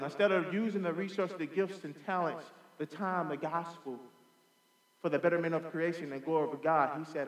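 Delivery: ordinary talk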